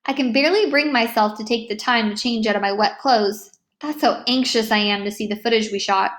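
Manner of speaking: usual speaking voice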